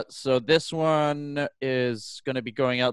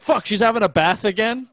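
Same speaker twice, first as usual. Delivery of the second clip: annoyed neighbor; 7 semitones higher, 3 dB louder than usual. Pretend. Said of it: "They're like".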